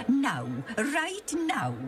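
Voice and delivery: slow, high, emphatic insistence. Acted. Junius answering his teasing friend, emphatic and slow. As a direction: insist firmly, drawing it out. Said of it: "Junius said quite emphatically".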